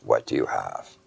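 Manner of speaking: put-on low, quiet German-accented question. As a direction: softly imitate a reserved German boss. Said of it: "He's got a German accent".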